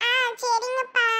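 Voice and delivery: put-on high, small child's voice, worried; little child voice. Little daughter asks her father what happened to mom, in a high child's voice. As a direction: play a small kid asking for mom.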